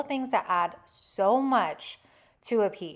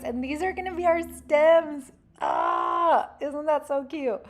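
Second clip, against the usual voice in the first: excited squeal; 7 semitones higher than usual. Real, not acted. Delighted by her own craft.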